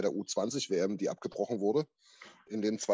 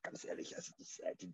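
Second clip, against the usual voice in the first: whispered aside; 5 semitones lower, 16 dB quieter than usual. Real, not acted. Aside whispered mid-sentence.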